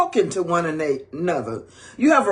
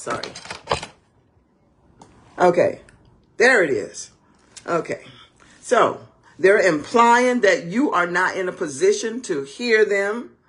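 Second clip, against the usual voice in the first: ordinary talk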